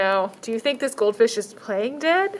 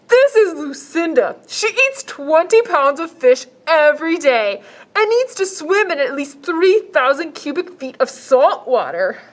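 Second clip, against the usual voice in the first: shelter man voice; 5 semitones higher, 5 dB louder than usual. Acted. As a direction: sell it like an eager shopkeeper.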